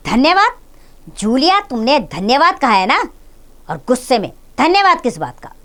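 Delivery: pace like an angry lion as you speak. A narrator's reading. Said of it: "क्रोध शेर की तरह".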